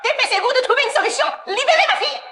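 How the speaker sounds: very high, rushed shrieking; hysterical demand. Acted. Hostage taker demanding her daughter back, hysterical. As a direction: shriek the order in desperation.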